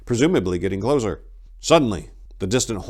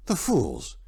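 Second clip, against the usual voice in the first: indignant outcry, rising louder; crying out; 7 semitones higher, 5 dB louder than usual. A narrator's reading. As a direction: shout across the trees. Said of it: "he cried out".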